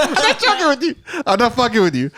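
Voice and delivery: high-pitched, laughing protest; cracking up while insisting; laughing insistence. Real, not acted. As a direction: swear it's true through real laughter. Swears story is true, amid laughter.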